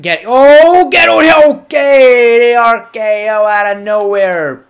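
high, very loud yell; excited shout. Real, not acted. Gamer calls out a surprise finisher, excited shouting. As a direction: yell it out like a big finishing move just landed.

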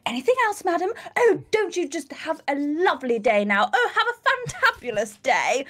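kill them with exaggerated politeness; sarcastic sweetness. Shop worker to a rude customer, sarcastically sweet and high. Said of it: "that's when I'm just like".

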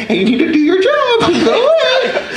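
high-pitched mock shout; hammed-up high, laughing protest; mock scolding. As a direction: send them off, climbing to a squeal. Acted retort to excited stranger.

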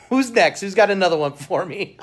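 cheeky dare, bring it on; excited. Real, not acted. Cocky after winning challenge.

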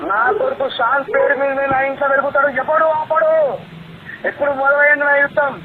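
high, loud furious yelling; angry shouting. Real, not acted. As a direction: rage at him, loud and high. Heated phone quarrel, shouting.

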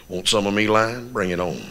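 low, quiet cocky challenge; understated macho dare; tough-guy swagger. Acted. Acts out a buffed-up lion challenger.

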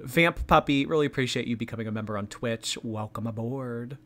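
ordinary talk, nothing marked

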